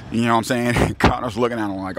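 ordinary delivery

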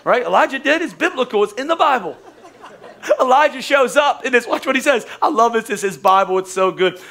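usual speaking voice

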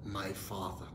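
soft, low spoken declaration; softly leading people in a declaration; confession. Shown as a demonstration. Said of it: "Say it with me".

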